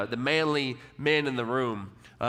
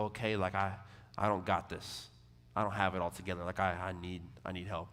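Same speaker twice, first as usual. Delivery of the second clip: soft, lower, surrendering; humble admission; 6 semitones lower, 8 dB quieter than usual. Retold or imitated. Believer humbly admitting need to God, soft. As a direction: let go and quietly ask for help.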